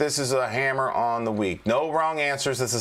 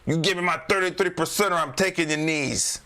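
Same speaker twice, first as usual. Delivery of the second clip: hammed-up Chicago mobster threat, pitched higher; J J, terrible Chicago accent; 5 semitones higher than usual. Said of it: "That's just my terrible, uh, uh, Chicago accent".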